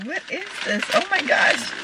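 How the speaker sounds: very high, soft gasp of wonder; hushed amazement. Real, not acted. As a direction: squeal softly in surprise. Opening a surprise gift.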